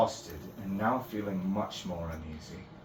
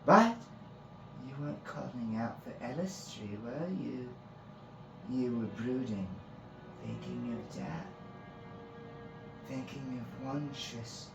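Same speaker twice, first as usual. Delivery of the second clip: higher, lilting voice, relishing the words; teasing fae priestess; 6 semitones higher than usual. Acted. Fae priestess taunting Finn about death, relishing. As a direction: savor the dark words, drawing them out.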